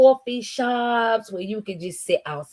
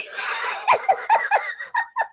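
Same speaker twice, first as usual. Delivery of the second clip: long, high-pitched cackle of real amusement; high wheezing laugh. Real, not acted. Streamer reading a viewer's coffee joke, real wheezing laughter. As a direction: crack up helplessly, high and wheezy.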